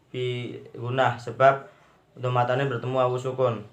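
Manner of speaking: ordinary delivery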